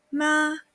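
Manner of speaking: MA high and level, held; ma long and flat, no movement in pitch; first tone. Shown as a demonstration. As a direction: hold ma steady on a high pitch. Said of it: "Con primer tono".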